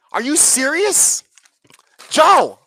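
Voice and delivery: loud, high yell of the name; exasperated shout. Real, not acted. Fed up with Biden's tax plan, exasperated shout. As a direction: throw the name out in frustration.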